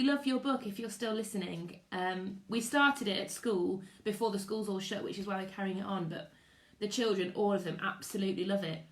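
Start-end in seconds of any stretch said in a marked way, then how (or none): none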